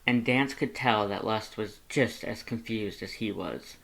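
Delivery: usual speaking voice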